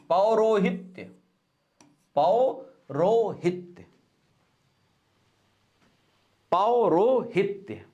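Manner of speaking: पौरोहित्य drawn out slowly, syllable by syllable; पौरोहित्य stretched out for dictation. Shown as a demonstration. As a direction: dictate पौरोहित्य slowly so students can write it. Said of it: "अगला बहुत important है".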